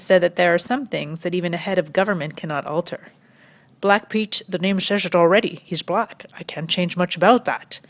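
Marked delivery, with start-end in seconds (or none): 3.8-7.8 hammed-up Dutch accent. Comedian quoting the Dutch Prime Minister, mock Dutch accent. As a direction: quote him in a thick comic Dutch accent. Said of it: "my Dutch accent is so amazing".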